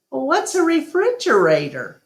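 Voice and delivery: put-on high, eager little elephant girl; young Ruby voice. Narrator voices little Ruby asking Ivan. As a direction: ask like a curious child.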